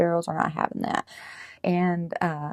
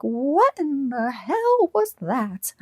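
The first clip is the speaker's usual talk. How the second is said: high, slow, put-on bird voice, the word hell stretched; a shocked little bird, much higher and drawn out; startled sparrow; 9 semitones higher, 4 dB louder, much slower than usual. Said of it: "looked down at her leg like".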